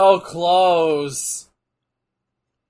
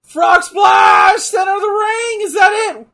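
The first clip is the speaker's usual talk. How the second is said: much higher, much louder fan yells; excited shouting; 12 semitones higher, 10 dB louder than usual. Real, not acted. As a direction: scream the finish at the TV. Match finish, excited fan.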